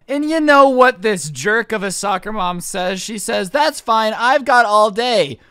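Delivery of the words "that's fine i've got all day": loud, high, drawn-out mock nonchalance; brazen customer. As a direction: imitate an entitled shopper, stretching the last words. Retelling customer's brazen reply.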